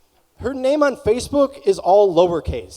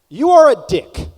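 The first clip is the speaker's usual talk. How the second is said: punchline shout; 8 semitones higher, 10 dB louder than usual. Acted. Punchline yelled at audience.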